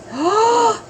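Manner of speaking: high surprised gasp; sharp gasp of shock. Real, not acted. Reacting to picture.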